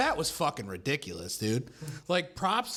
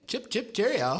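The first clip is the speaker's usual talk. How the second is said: mock British accent; 8 semitones higher than usual.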